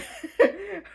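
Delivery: bursting high laugh; real laugh. Real, not acted. Vlogger laughing at swearing on her own channel, a high laugh. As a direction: break into a laugh.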